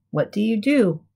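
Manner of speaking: plain reading voice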